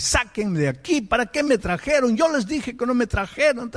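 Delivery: high-pitched retold protest, volume held back; retold yelling. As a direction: imitate an agitated man demanding to leave. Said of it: "Él comenzó a gritar, pero así, y, y a gritar a los padres".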